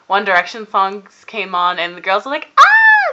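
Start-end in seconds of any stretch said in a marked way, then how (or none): 2.6-3.1 high, loud fangirl squeal; piercing mock scream of excited fans. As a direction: imitate girls shrieking with excitement. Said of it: "they started freaking out".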